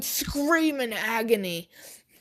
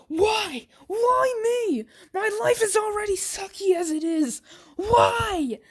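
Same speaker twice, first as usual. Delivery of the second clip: high wailing cries; anguished high crying yell; crying shout; 13 semitones higher, 4 dB louder than usual. Acted. Captive cries out in pain.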